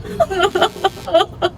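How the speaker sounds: quick, shuddering gasps; acted panicky panting; panicked sobbing breaths. Wife discovering the necklace is gone, panicked gasping.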